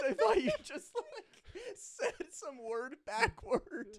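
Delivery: high, loud laughing talk, slowing at the end. Real, not acted. Friend laughs admitting she misheard the names, laughing. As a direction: talk through the giggles.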